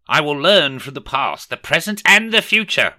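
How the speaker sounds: high, loud villain-turned-good Scrooge. A narrator's reading. Scrooge vowing to himself to change, high and loud.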